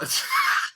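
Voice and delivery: a quick amused laugh; short laugh. Real, not acted. Laughing at his own bad idea, short laugh.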